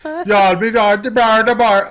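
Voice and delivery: hammed-up Jamaican patois; mock Jamaican accent. Joking about the narrator's Jamaican accent in mock Jamaican. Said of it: "Had that deep Jamaican accent".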